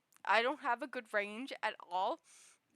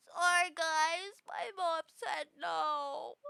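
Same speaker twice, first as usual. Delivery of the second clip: slow, higher mock-sad whine; Kel voice, sad; 6 semitones higher, much slower than usual. Kel turning down friends, mock-tearful and slow. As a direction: whimper it slowly like a sulky kid. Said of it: "with that voice".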